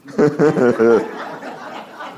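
long, odd chuckle; imitated laugh. Driver laughs after threatening the passenger, imitated laugh. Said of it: "he laughed again".

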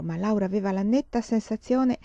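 ordinary delivery, nothing marked

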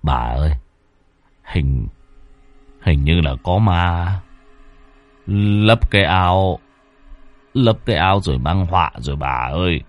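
put-on low, halting old husband's voice; fearful stammering. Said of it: "Ông Cạc bấy giờ cũng lắp bắp". (ông già lắp bắp sợ hãi, giọng trầm ngập ngừng)

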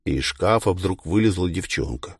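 ordinary delivery, nothing marked

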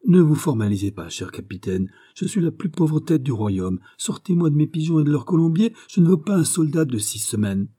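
high-pitched, effusive self-reproach; fussy governor begging pardon; flustered apologetic governor. Acted. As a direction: placate the captain, pitch climbing. Baisemeaux placating the offended captain.

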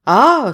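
loud, high gasp of alarm; a sharp cry, caught off guard; sudden shocked exclamation; startled ah. A narrator's reading. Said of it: "s'écria monsieur Trevor".

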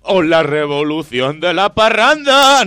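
loud, drawn-out, rising shout; mock rallying cry. Host spoofing the call to revolution, hammed-up shout. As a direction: bellow it like a party slogan.

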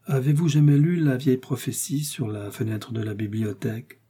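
plain reading voice

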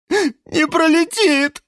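loud, high drawn-out wail; sobbing. A narrator's reading. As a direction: burst into hammed-up sobs. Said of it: "вдруг всхлипнул Жорж".

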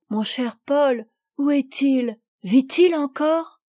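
pressing for news of Paul; eager anxious questions. Acted. Sophie begging the commandant for news of Paul, eager.

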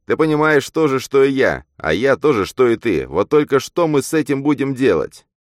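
loud, high-pitched challenge; heated outburst. A narrator's reading. Man demands what they will do, heated. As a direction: press her with rising-voiced urgency.

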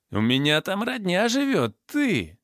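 high, aggrieved challenge; acted angry confrontation. A narrator's reading. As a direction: pitch up as you square off. Drunk thug confronts Roma. (возмущённый наезд на высоких тонах)

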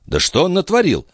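high-pitched puzzled question; growing surprise. A narrator's reading. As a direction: ask in astonishment, pitch lifted. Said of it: "спросил Филипп с возрастающим удивлением".

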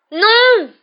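very high, drawn-out scream of protest. A narrator's reading. Girl horrified at her professor's embrace, screaming. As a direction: scream the refusal at full voice. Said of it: "hurla Hermione".

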